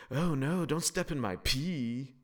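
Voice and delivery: playful pretend warning; mock warning. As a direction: warn them in a goofy drawn-out voice. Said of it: "to warn people".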